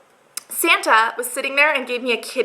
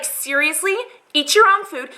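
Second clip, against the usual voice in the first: raised-pitch, exasperated comeback; indignant retort; 5 semitones higher than usual. Retold or imitated. Answering people who tell her what to eat, indignant. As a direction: fire back at a nosy critic. Acted retort to food critics.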